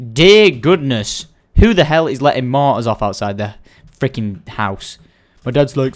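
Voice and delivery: ordinary talk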